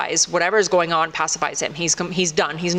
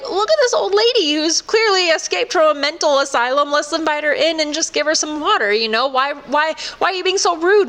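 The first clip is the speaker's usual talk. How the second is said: clueless husband voice; 7 semitones higher than usual. Retold or imitated. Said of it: "the husband ... is just like".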